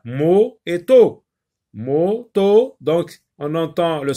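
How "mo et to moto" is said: "mo" and "to" slow, loud, held apart; teacher isolating each syllable of "Moto", raised voice; syllable breakdown. Shown as a demonstration. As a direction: stretch "mo", then land on "to". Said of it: "Donc il y a deux syllabes".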